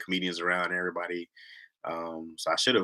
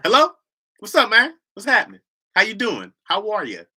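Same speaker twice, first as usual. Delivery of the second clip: loud, high, clear phone greeting; wide awake voice; 10 semitones higher, 6 dB louder than usual. Shown as a demonstration. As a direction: demonstrate a fully open, cleared throat. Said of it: "when you wide open".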